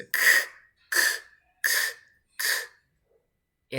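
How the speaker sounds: "k" as a bare click of air; unvoiced K sound. Shown as a demonstration. Teacher quizzing the class on K, voiceless separate bursts. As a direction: repeat "k" with no vowel after it. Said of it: "Is it air?".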